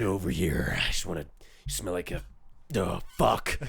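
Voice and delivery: low, softened pretend come-on; mock lustful partner. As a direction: play a partner overcome with attraction. Plays someone into partner's smell.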